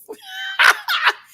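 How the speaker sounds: loud, high-pitched cackle; squealing burst of laughter. Real, not acted. Breaks up at own remark.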